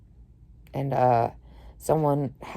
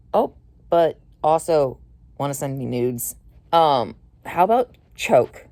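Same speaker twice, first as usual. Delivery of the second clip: slow, loud mocking sneer; sarcastic drawl; 5 dB louder than usual. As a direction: drag it out to mock him. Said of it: "They're like".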